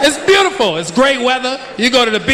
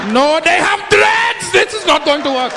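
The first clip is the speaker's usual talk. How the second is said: lightly acted dismayed hijacker, drawn out; mock hijacker panic, slow start; hijacker's dismay; 4 semitones higher, slower than usual. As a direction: stretch out the alarm in mock dismay. Acting out a hijacker aboard Air Jamaica.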